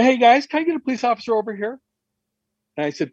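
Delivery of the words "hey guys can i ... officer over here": calling out for police. Retold or imitated. Calling police across the room.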